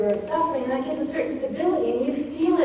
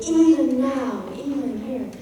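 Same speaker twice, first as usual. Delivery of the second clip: "Even now" loud with each syllable stretched, then "even here" held; louder, extended syllables. Shown as a demonstration. Teacher showing how the reader summons energy on the line. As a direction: give "Even" and "now" full voice and length. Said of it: "you're speaking at a higher volume, you're extending the syllables".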